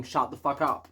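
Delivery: normal talking voice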